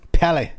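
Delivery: high, punched PALLY; imitating wrestler's emphasis. Host mimicking how the wrestler says his pet word, emphatic. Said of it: "It's the way he says it".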